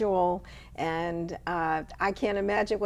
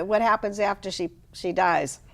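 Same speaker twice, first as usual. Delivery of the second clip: usual speaking voice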